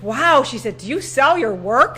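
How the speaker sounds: high, loud, slow amazed voice; a buyer awed by the art; impressed stranger. Retold or imitated. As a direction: marvel at the painting.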